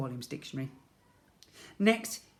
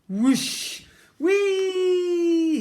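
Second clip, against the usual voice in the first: high, loud gleeful whoosh and whee; excited whee; 14 semitones higher, 9 dB louder than usual. A narrator's reading. Ned sliding across the slime field, exclaiming with glee. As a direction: shout it like a thrilled kid on a slide. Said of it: "he exclaimed".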